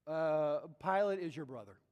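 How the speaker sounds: ordinary talk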